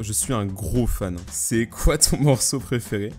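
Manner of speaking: spaced-out words while typing; measured typing voice; slow dictation. Real, not acted. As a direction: speak as slowly as you type.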